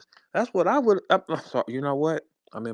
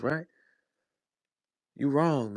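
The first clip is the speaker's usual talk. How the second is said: normal talking voice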